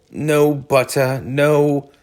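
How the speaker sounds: slow, sorry groan; Mole's glum groan continues. A narrator's reading. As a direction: drag it out glumly. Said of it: "groaned the mole".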